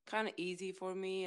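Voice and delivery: ordinary delivery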